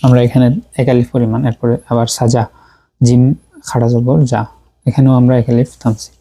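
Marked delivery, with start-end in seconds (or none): none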